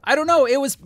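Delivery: a droid driver shrieking while under fire; panicked droid. Acted. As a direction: cry it out mid-crash.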